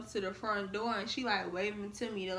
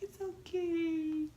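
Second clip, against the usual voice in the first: high, very slow comforting voice; soothing friend; 8 semitones higher, much slower than usual. Retold or imitated. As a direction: draw it out gently like calming a crying friend. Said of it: "She's like".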